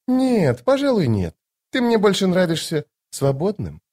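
high, musing lady's voice; decide lightly in a high register; Lady Envy, relenting. Acted. Lady Envy letting Toc stay free, high and musing.

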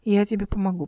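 plain reading voice

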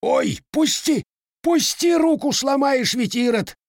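howling in pain. A narrator's reading. Man pinned by the lad howls to be let go. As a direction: howl like your arm is about to snap. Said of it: "взвыл дурным голосом".

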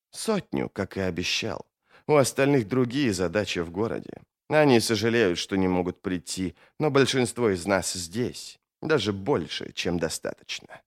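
low, soft, controlled reply; quietly confident villain, keeping the voice down; composed menacing foe. Acted. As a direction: answer low and unhurried, sure of your numbers. Mamun answers the mage.

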